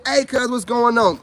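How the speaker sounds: calling out. Real, not acted. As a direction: call across casually to someone in the room. Calls out to cousin in room.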